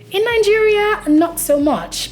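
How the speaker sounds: Nigeria high and loud, much slowed; emphatic contrast. Real, not acted. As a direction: punch the contrast.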